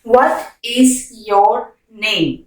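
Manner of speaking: slow and smooth, the pitch dropping on name; slow teaching question. Shown as a demonstration. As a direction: ask it slowly in one phrase. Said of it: "slow repeat".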